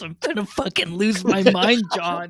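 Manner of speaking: loud, exasperated with the camera; frustrated outburst. Real, not acted. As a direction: vent at the broken camera.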